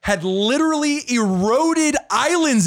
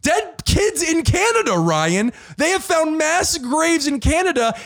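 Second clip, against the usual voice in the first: impassioned outburst; 7 semitones higher than usual. Real, not acted. Blurts answer, mock-outraged rant.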